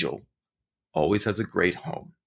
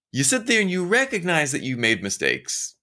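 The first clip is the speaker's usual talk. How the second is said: ordinary delivery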